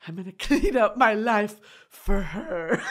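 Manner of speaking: mock blubbering, breaking into laughter; hammed-up maudlin promise, laughing through it; drunken vow. Drunk man vows to sober up, mock blubbering.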